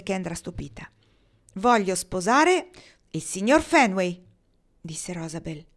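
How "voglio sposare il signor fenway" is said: slow and much louder, each word set down; loud declaration. A narrator's reading. Rosabel revealing to Kendra whom she loves, slow loud declaration. Confessing her wish.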